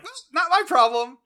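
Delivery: high, loud mock brush-off; dismissive brush-off. Acted brush-off of Kay's plight.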